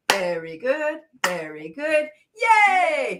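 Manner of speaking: praise, then cheer yay. Shown as a demonstration. Leader praising the group between games, cheering. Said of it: "we may say".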